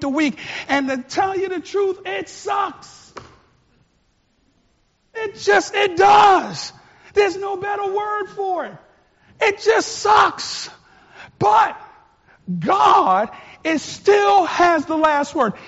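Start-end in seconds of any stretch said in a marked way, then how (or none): 5.1-15.5 high, loud, near shouting; worked-up preaching. Real, not acted. Preacher venting about covid, high excited shout. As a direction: let the frustration boil over. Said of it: "let me calm down".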